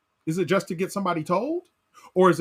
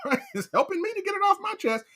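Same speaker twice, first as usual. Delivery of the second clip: higher, laughing self-excuse; mock self-justifying listener; 11 semitones higher than usual. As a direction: play the selfish listener, amused. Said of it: "some of us, we've already concluded".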